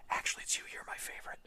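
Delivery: hushed secret whisper of a confession. Pretend. Whispering to the Ossiarch Bone Reapers that they are the favourite. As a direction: breathe it like a guilty secret.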